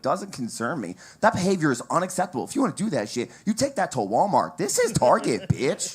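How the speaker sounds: rising indignation, ending in a punchline; building righteous rant. Retold or imitated. Comic to a mom in Target, building to an indignant shout.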